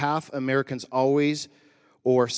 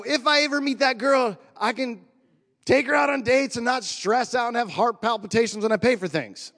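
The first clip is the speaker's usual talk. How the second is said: high, louder mock-hopeful voice; hammed-up daydreaming, pitched way up; silly put-on voice; 10 semitones higher, 5 dB louder than usual. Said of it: "I don't know why I use that voice".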